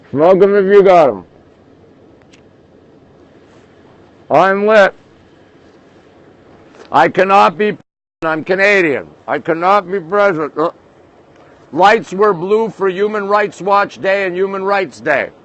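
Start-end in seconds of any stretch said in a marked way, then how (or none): 0.1-10.7 talking around a lit cigarette; words slightly muffled by a cigarette; cigarette in mouth. Real, not acted. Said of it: "Let me light a cigarette".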